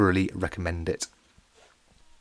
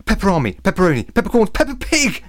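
high, loud, excited list; 10 semitones higher, 6 dB louder than usual. A narrator's reading. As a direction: blurt them out, building to the last item. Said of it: "said Big Ed excitedly".

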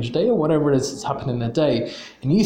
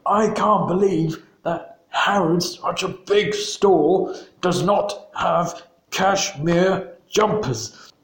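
slow, higher, indignant mock outrage; retold angry customer, pitched up and slow; 6 semitones higher, slower than usual. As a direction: play the furious customer, dragging it out. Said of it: "he got really, really angry, and he was like".

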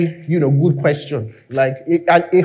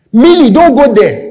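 calling out to sheep; 8 semitones higher, 8 dB louder than usual. Acted. Moses calling to his sheep.

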